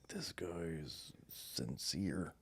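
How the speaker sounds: slow, hushed whisper; awed whisper. Retold or imitated. A city guy's hushed reaction to the earnest hunter, whispered. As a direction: whisper it in stunned awe. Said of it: "And I was like".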